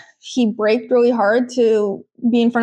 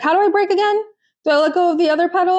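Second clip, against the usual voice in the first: mock clueless learner; 6 semitones higher, much faster than usual. Playing clueless learner driver.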